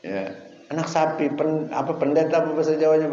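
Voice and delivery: ordinary delivery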